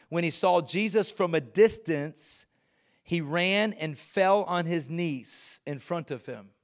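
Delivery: slow, deliberate scripture reading; measured, weighty telling. Shown as a demonstration. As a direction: let each phrase land. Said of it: "reread faster".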